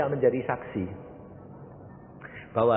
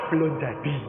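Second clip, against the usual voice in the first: unruffled answer; 2 semitones higher than usual. Acted. Pastor's calm answer to protest.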